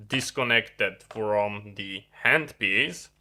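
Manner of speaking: ordinary talk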